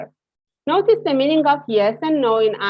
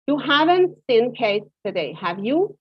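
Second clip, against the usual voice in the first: "have you?" lifted up at the end; rising tag. Shown as a demonstration. Teacher models a real question for the class. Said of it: "Have you" is a rising intonation".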